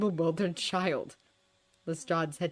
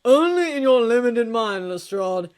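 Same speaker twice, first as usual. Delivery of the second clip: loud, higher, condescending jab; cutting retort; 5 semitones higher, 11 dB louder than usual. A narrator's reading. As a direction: sneer it down the phone.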